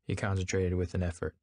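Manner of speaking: ordinary delivery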